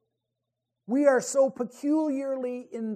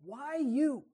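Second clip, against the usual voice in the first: hurt question; 4 semitones higher than usual. Pretend. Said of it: "In other words".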